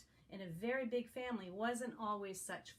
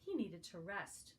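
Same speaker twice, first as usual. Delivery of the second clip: plain reading voice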